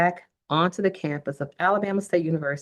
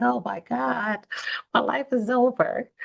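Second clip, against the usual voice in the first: high, wailing "my life is over"; dramatic despair; 7 semitones higher than usual. Retold or imitated. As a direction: play up the teen drama of it. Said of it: "really dramatic".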